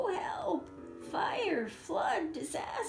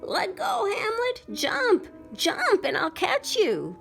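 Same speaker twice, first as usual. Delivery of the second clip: high, loud urgent calls; tiny voice yelling from below; 12 semitones higher, 7 dB louder than usual. Acted. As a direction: shout up to him to jump.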